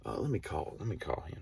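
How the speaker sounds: whispered, under her breath; whispering to herself. Retold or imitated. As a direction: mutter it to yourself in a whisper. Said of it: "so she's like".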